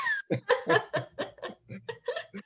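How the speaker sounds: a high, rising giggle; high laugh. Real, not acted. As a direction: giggle up high at her own trick. Laughs at planning boyfriend's trips.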